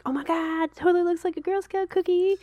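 high, thrilled gushing; delighted. Retold or imitated. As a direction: gush like it's a Girl Scout cookie. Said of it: "I'm like".

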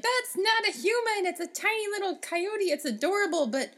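high, flustered new mum; flustered mother. Retold or imitated. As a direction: gush over the baby, panicking. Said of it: "she's like".

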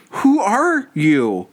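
half-hearted retold yell, drawn out; mock shout. Host retelling Johnny shouting at strangers, mild mock shout. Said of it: "that gets shouted at these people".

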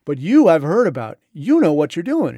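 butter him up, stressing "you"; flattering emphasis. Retold or imitated. Flattering the general.

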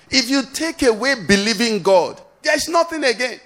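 high-pitched, urgent exhortation; impassioned pulpit appeal; full-force preaching. Real, not acted. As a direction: urge the congregation to believe right now.

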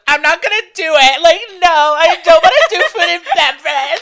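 high, loud whining through a laugh; imitating her friend's loud whiny no; whiny mock refusal. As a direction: whine it like a spoiled kid who won't go.

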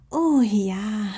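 very high, purring fox voice; a happy fox cub agreeing; purring yes. Acted. (schnurrendes hohes Ja)

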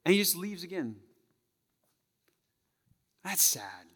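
ordinary talk

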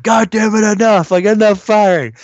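high-pitched mock yell; exasperated command. Retelling the agent halting the shooting, exasperated mock command. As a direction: imitate a fed-up man ordering it to stop. Acts out Goodman's exasperated order.

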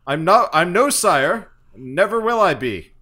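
loud, high, barking officer; angry commander. Acted. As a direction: bark it like an irate field marshal. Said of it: "he's like".

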